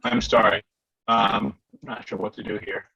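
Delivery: ordinary talk, nothing marked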